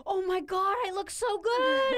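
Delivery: high, loud imitation of a thrilled client; hammed-up squeal of delight; uncontainable excitement. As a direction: gush it, stretching the words out. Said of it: "they can't contain the energy anymore".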